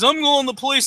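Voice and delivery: mocking, high-pitched whine; whiny kid. Retold teen on the floor, whining to the cashiers. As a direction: mimic a sore loser crying foul. Kid repeats threat to cops.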